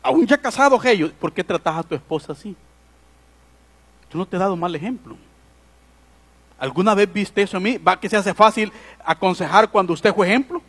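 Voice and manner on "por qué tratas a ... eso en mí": soft, quick reproach from a father; quiet fatherly reproach. Retold or imitated. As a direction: hush it like a father correcting his grown son. Father reproaching married son.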